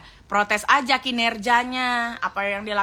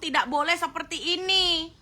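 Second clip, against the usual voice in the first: ordinary talk, nothing marked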